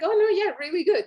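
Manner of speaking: a light imitation of someone hurrying to say it's good; mock reassuring gush. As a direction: toss it off fast and bright like a polite fib. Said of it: "She's like".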